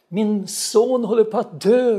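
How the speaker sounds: high, loud call of a retold mother; imitated desperate cry. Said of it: "ropar hon". (återberättat högt, förtvivlat rop)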